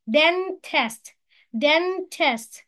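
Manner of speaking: "dentist" broken as den... tist; split into syllables. Shown as a demonstration. Teacher shows two syllables, "dentist" split in two. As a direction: say "dentist" in two separate beats. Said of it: "كل دي كلمات فيها two syllables".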